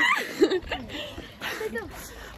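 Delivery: high, breathy giggle; surprised happy giggle; high laugh. Real, not acted. Greeting friend.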